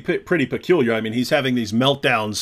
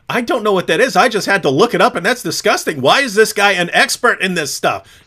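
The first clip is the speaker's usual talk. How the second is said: higher, emphatic mock outrage; retold disgusted disbelief; 7 semitones higher, 4 dB louder than usual. Voicing viewers shocked by what they looked up, indignant disbelief. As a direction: protest like an appalled onlooker. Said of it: "people were saying".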